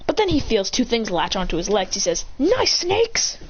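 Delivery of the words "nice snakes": high-pitched acted exclamation; startled exclamation. Snakes latched onto his legs, startled cry. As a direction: call out high at the sudden sight.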